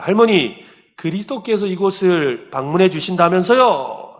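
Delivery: acted pastor addressing grandmother, moderately loud; pastor asking. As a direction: call the question out to an elderly woman. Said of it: "그 할머니에게 다가가서 이야기합니다".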